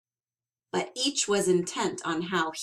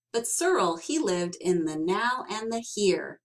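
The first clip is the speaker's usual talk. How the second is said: ordinary delivery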